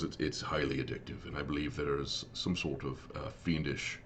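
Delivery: low, subdued, halting in-character reply; lightly voiced Tyron. Tyron explains his errand to the tall elf, lightly voiced and subdued.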